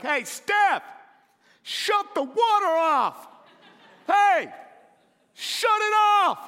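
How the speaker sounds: loud, high shout to the next room; man on the couch yelling for his wife to shut the water off, loud and high. Retold or imitated. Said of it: "I'm screaming".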